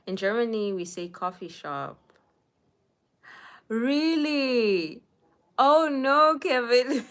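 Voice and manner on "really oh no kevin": loud, high mock-dismayed "oh no"; playfully shocked at the viewer's news; surprised. Real, not acted. Comment says coffee shop in Germany.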